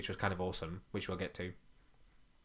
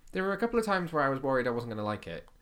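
ordinary talk, nothing marked

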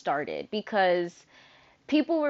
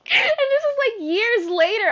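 laughing while talking; 13 semitones higher, 8 dB louder than usual. Real, not acted.

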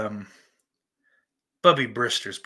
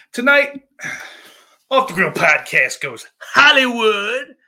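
loud, high show-opening proclamation; showy announcer; 10 semitones higher, 11 dB louder than usual. Acted. Host revealing tonight's show theme, loud announcer hype. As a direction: sell the big theme like an announcer.

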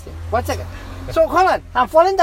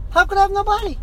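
retold scream; 8 semitones higher than usual. Man recounts losing his body, retold scream. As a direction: recall screaming in disbelief, pitch raised. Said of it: "I start to scream".

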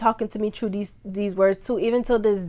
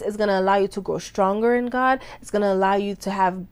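ordinary talk, nothing marked

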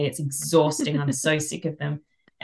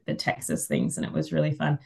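usual speaking voice

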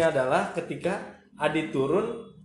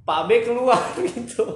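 usual speaking voice

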